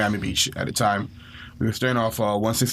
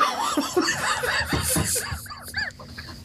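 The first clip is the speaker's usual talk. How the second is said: high laugh; 5 dB quieter than usual. Real, not acted. Laughing at own story.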